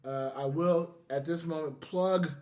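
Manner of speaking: normal talking voice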